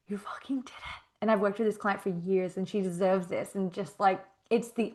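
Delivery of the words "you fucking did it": retold hushed, whispered praise; awed whisper. Coach retelling praise to her client, in an awed whisper. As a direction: whisper it in proud disbelief.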